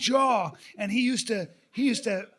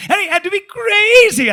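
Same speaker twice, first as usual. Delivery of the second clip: much higher and louder, wild; crazy act; 11 semitones higher, 11 dB louder than usual. Retold or imitated. Re-enacting the audition, he plays it loud and crazy. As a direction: blast it out like a madman. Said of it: "something like that".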